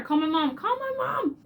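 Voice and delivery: hammed-up child's begging, voice shooting up; pleading wail. Child shifting blame onto mom, mock pleading wail.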